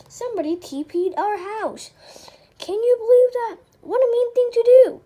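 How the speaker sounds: high, loud, breathless disbelief; a kid scandalized by the prank; shocked outrage. Acted. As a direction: gasp it out, appalled. Outraged at toilet-papered house.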